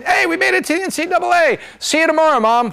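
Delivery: high-pitched, hammed-up player cheer; mock excitement. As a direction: call out excitedly to Mom, imitating a player. Acts out excited player calling Mom.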